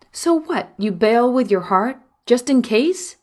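yelling. Retold or imitated. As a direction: shout over the crying baby. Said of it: "I yell over River's howls".

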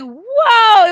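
loud high gasp of surprise; a big stunned whoa, high and loud; surprised. Retold or imitated. Surprised by crowd outside.

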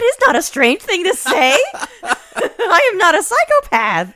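loud, high laughing protest. Real, not acted. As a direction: laugh it out indignantly.

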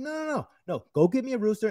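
high-pitched, insistent cutting-off; re-enacted stubborn comeback; defiant refusal. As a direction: shut the objection down and demand it. Acted refusal to French chef.

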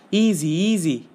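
calm them down, anxiously; urgent soothing. A narrator's reading. Frodo to men tying Gollum, pleading.

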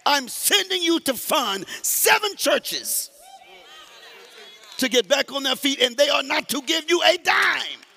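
high, loud, shouted sermon climax; chanting preacher, near singing; preaching at full force. Acted. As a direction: whoop it up like a revival preacher.

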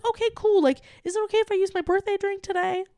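high, light casual ask. Retold or imitated. Customer asking barista for a birthday drink, casual. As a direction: imitate a breezy, no-big-deal request. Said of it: "making it as casual as possible".

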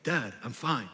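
a two-year-old's mock deep voice; deep-voiced toddler. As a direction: play the boy digging in, voice dropped low. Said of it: "he gets real, like, strong".

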